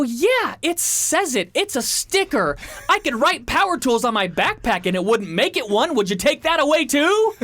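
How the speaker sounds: high-pitched incredulous rant; indignant. Retold or imitated. Traveler arguing over the sticker, indignant. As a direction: argue the obvious in rising exasperation.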